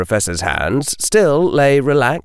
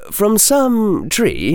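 plain reading voice

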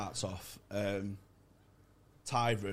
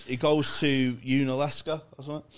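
ordinary talk

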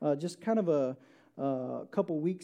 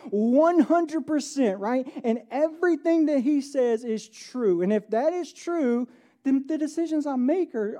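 raised, louder preaching to the congregation; 4 semitones higher, 5 dB louder than usual. Acted. Pastor urging the church, preaching loud and raised. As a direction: preach it out with full force.

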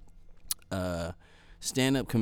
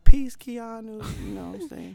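mock cool; 11 semitones higher, 7 dB louder than usual. Cool farewell to Keanu.

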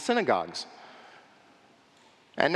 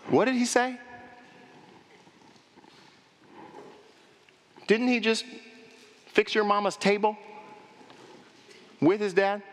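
raised, incredulous questioning; doubting neighbor; 6 semitones higher than usual. Retold or imitated. Hometown crowd doubting Jesus, raised and incredulous. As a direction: sound puzzled and suspicious.

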